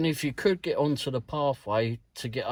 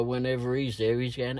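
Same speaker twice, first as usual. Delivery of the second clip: normal talking voice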